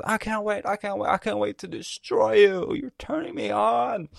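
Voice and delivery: high-pitched, hammed-up villain in ecstasy; aroused villain. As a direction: gush with mock arousal, drawing out the ending. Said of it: "Literally, that's why he said".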